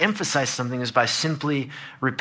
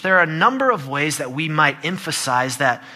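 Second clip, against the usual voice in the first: ordinary delivery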